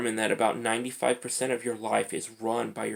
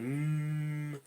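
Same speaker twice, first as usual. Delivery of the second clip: long, soft, higher-pitched "mmm"; meditation hum; 5 semitones higher, 6 dB quieter than usual. Retold or imitated. Mimicking someone meditating under a tree, long soft hum. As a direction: drone a mock meditator's hum. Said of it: "sitting under a tree going".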